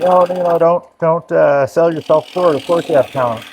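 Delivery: reassuring friend. Retold or imitated. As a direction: play the kind friend brushing it off. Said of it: "It's like".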